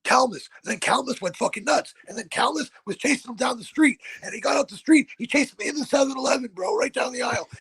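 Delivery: high, fast, excited retelling; excited storytelling. Retold or imitated. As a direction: pitch way up, rattle it off hyped. Excited story about Calmus chasing.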